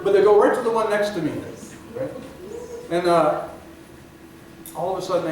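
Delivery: ordinary talk, nothing marked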